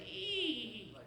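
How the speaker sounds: high thin squeak mimicking a creaking step; creaky squeak. Creepy stairs in a horror movie, high squeak.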